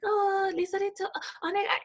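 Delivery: high, soft imitation of a Japanese boss; mimicked hushed, high-pitched summons; quiet boss. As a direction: call her over politely in a small high voice. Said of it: "she said very quietly".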